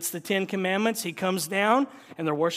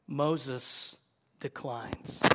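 ordinary delivery

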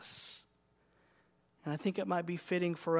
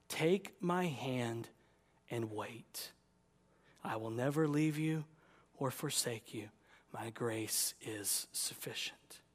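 soft, low, hushed promise; Jesus reassuring; 4 semitones lower, 5 dB quieter than usual. Acted. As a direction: soothe someone suffering, trailing into near-whisper. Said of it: "And Jesus says".

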